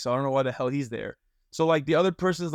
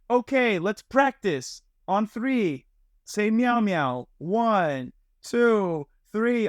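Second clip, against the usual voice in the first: imitating the maid; 7 semitones higher, much slower than usual. Maid counts guests in to say meow, high and sing-song. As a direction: lead the guests in practice like the cheery maid. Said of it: "she was like".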